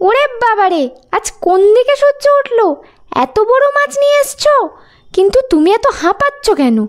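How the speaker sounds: high-pitched, amazed wife voice; excitable high voice of a nagging fox wife; astonished fox wife. Acted. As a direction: exclaim in disbelief, then question him. Fox's wife amazed, then suspicious.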